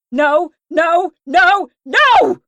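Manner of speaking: high, very loud scream. Acted. Mia screaming as they touch her. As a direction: scream it endlessly. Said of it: "I endlessly screamed".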